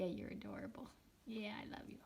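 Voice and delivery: tender hushed whisper. Real, not acted. Mother whispering to baby.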